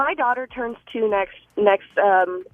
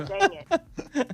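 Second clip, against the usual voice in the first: retold yell; 8 semitones higher than usual. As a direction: imitate your own caught-on-video yelp, higher. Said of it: "you can hear me yell".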